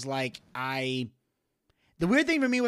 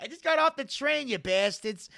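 high cartoon dad impression, pushed up in pitch; Peter Griffin voice; 9 semitones higher, 4 dB louder than usual. Retelling the impersonator at the station, high Peter Griffin voice. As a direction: grumble like the cartoon dad off the train. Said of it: "And he's like".

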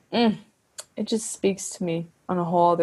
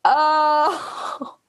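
high, choked-up little cry; overwhelmed, voice breaking; emotional cry; 8 semitones higher than usual. Real, not acted. Podcaster overcome naming her favourite album, choked-up cry.

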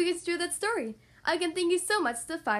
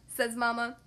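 plain reading voice

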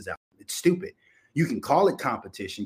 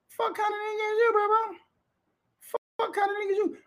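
very high-pitched squeaky disbelief; mock incredulity. Host mocking the rapper for going unarmed, squeaky incredulity. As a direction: squeak the question in put-on astonishment.

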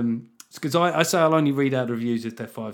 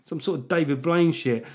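usual speaking voice

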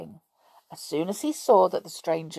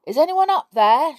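high loud shout upward; 10 semitones higher, 9 dB louder than usual. Acted. Boy shouting up the tree, high and loud. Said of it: "shouted the boy".

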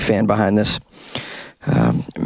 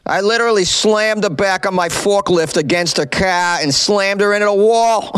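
higher, gleeful retelling in a put-on voice; hammed-up quote of Perlmutter; 6 semitones higher than usual. Host reading operator's quote, hammed-up proud voice. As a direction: play the proud forklift operator. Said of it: "Here's a quote from Eric Perlmutter".

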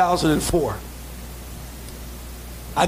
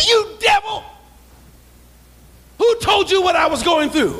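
rebuking the devil; 11 semitones higher than usual. Retold or imitated. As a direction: call him out as a devil. Confronting the caller as a devil.

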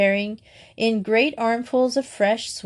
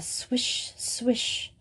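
whispered swish; 4 semitones higher, 7 dB quieter than usual. A narrator's reading. Narrator imitates rain sound.

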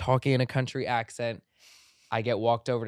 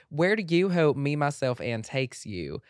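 loud, higher Southern lady voice, slowing at the end; lady country accent; 4 semitones higher, 6 dB louder than usual. Shown as a demonstration. Host reading the question like a country lady, Southern belle accent. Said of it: "a lady country voice".

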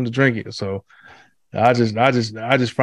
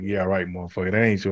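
sarcastic aside; 5 semitones lower, 7 dB quieter than usual. Retold or imitated. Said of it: "I'm like".

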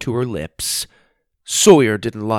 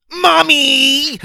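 very high, loud, crying scream for mommy; terrified shout; 7 dB louder than usual. Acted. Sawyer screaming for his mom at the flinching kangaroo, terrified shriek. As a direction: shriek it in pure panic. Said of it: "Sawyer shouted involuntarily".

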